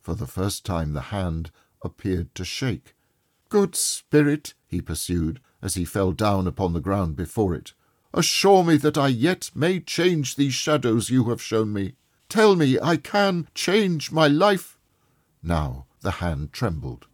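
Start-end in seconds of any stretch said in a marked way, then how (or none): 3.5-4.5 higher, slow imploring; desperate plea. A narrator's reading. Scrooge begging on the ground. 8.1-14.8 higher, louder desperate begging; anguished pleading. A narrator's reading. Scrooge begging the ghost.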